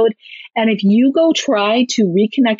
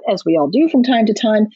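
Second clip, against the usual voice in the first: usual speaking voice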